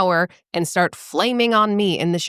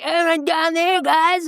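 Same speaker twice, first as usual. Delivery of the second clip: pixie voice; 11 semitones higher, slower than usual. Retold or imitated. Mocking the rude guys.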